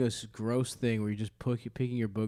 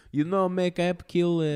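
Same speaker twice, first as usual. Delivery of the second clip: mock Israeli accent; 6 semitones higher, 5 dB louder, slower than usual. Continues the mock voice.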